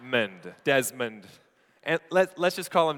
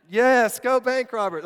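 high, loud, wholehearted cheer; root for them with full conviction; emphatic acted fan cheer; 10 semitones higher, 7 dB louder than usual. Acting a viewer deciding to cheer.

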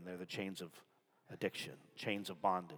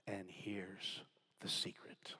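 conspiratorial whisper; 2 semitones lower, 10 dB quieter, slower than usual. Acted.

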